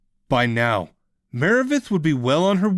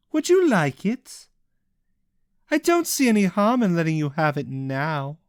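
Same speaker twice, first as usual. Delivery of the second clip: higher, lightly voiced taunting; mocking villainess; 8 semitones higher than usual. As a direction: offer it with false kindness. Cassian taunts Ishel.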